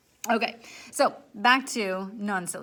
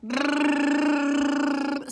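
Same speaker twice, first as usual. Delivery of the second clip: lip blowing; 5 semitones higher, 7 dB louder than usual. Shown as a demonstration. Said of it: "Can you do that?".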